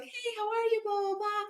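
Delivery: high, bubbly imitation of the mom; chatty mom voice. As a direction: chirp it like an overeager acquaintance. Mom voiced in parking-lot story.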